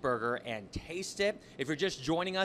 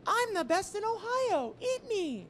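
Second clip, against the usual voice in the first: high, slow cartoon food voice; squeaky voice of a boastful burger; talking burger voice; 6 dB louder, slower than usual. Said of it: "It almost talks to you before you come out".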